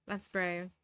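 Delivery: ordinary delivery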